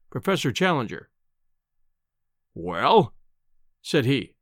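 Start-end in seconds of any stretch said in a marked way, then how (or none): 2.5-3.1 curt, rising challenge; a haughty, impatient prompt; insolent. A narrator's reading. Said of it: "with a most insolent stare".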